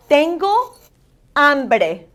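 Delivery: review "Tengo hambre" word by word; teacher's clear model "Tengo, hambre", vowels full; accentuated Spanish vowels. Shown as a demonstration. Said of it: "vowels are very important that you accentuate".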